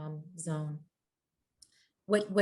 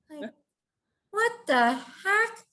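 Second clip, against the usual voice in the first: high, drawn-out exasperation; indignant disbelief; 11 semitones higher, slower than usual. Retold or imitated. Organizer reacting as her event is taken over, indignant. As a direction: stretch each word slowly in disbelief.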